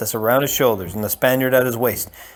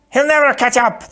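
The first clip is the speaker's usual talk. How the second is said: much higher, louder protest; crying out; 13 semitones higher, 5 dB louder than usual. Acted. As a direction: insist at full voice. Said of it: "the Sicilian cried".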